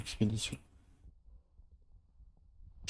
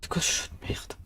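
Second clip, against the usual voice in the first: muttered under breath; 6 dB quieter than usual. Real, not acted. Frustrated by a bad game.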